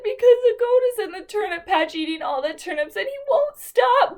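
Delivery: crying animals complaining to the wolf. Acted. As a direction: blurt it out in a hammed-up sob. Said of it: "they said".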